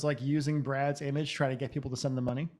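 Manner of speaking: ordinary talk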